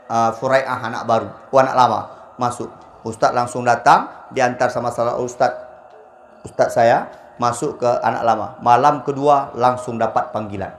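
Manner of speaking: ordinary talk, nothing marked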